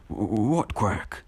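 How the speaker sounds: slow, nervous stammer on the first word; imitated stuttering. Bakugo questions Izuku about his quirk, stuttering. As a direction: trip over the opening word, mock-frightened. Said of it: "stuttering, like".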